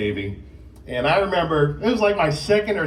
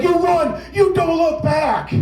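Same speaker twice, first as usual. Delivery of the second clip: panicked yell; 11 semitones higher, slower than usual. Retold or imitated. Big brother yelling at scared little brother to run away. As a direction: yell at him to run like doom is coming.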